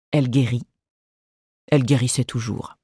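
plain reading voice

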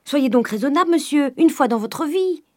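high, loud scolding from the servant; crying out. A narrator's reading. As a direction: scold the master at full voice. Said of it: "cria Nanon". (remontrance criée, voix haut perchée)

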